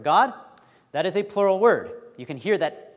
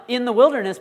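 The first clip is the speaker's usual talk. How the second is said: "In the wilderness" called out higher and louder; mock yell; 8 semitones higher, 8 dB louder than usual. Teacher acting out the prophet calling to the class. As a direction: call it out like a voice across the desert. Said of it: "somebody yelling out".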